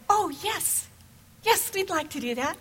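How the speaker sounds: put-on high, eager kids answering yes; eager children.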